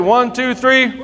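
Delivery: high, rising count to three. Real, not acted. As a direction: count them in like a game host. Counting kids in.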